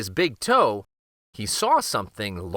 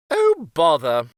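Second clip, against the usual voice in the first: higher, louder exasperated sigh; grumbling king; 4 semitones higher, 6 dB louder than usual. Acted. King giving in to the queen, exasperated. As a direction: huff it out as the king. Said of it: "said the king".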